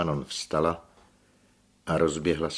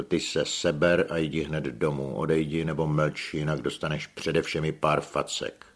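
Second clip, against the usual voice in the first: ordinary delivery, nothing marked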